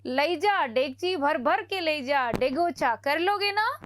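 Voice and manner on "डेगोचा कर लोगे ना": ordinary delivery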